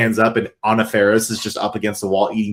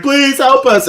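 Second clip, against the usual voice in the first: high, louder imitated cry for help; mock desperate plea; 14 semitones higher, 5 dB louder, slower than usual. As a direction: beg in a hammed-up high voice. Retold girl begging at the door.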